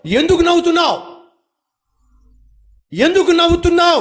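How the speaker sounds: high, ringing voice of God asking why; God questioning. Acted. God questioning the doubting listener, lightly voiced. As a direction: ask it as God would, searching. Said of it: "దేవుడు నిన్ను ప్రశ్నిస్తున్నాడు".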